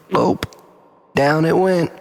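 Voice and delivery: ordinary delivery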